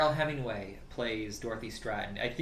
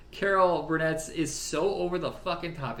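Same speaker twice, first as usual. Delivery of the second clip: normal talking voice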